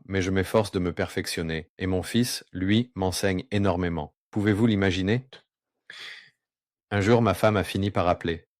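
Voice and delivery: usual speaking voice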